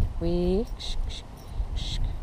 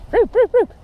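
calling dog; 12 semitones higher, 4 dB louder than usual. Real, not acted. Quick high calls.

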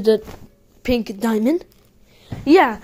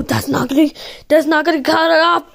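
normal talking voice

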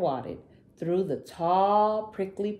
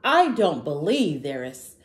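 higher, louder defiant shout; a bold little dinosaur shouting that he isn't scared; 4 semitones higher, 5 dB louder than usual. Acted. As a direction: shout it out, refusing to believe. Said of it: "he shouted".

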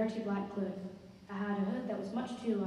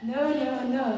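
raised, mock-scream refusal; 4 semitones higher, 8 dB louder than usual. A narrator's reading. Narrator refusing the clinging child, screaming. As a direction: push it louder and higher, short of screaming. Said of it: "I screamed".

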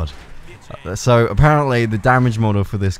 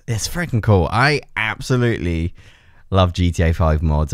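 ordinary delivery

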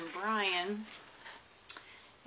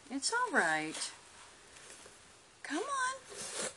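pet talk; 11 semitones higher, 5 dB quieter than usual. Real, not acted. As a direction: coax a shy pet out gently. Coaxing the lizard out.

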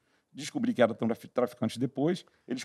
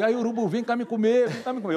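calling the vultures; 8 semitones higher than usual. Retold or imitated. Calling vultures to eat him.

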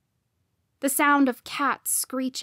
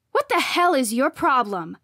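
loud angry challenge; sharp, raised-voice confrontation; snapped; 3 semitones higher, 6 dB louder than usual. A narrator's reading. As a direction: snap it at a rival. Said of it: "I snapped".

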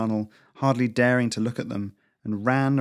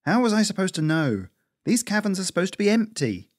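put-on higher, defensive young squirrel; 9 semitones higher, 3 dB louder than usual. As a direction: argue back, stung and defensive. Cora defends herself.

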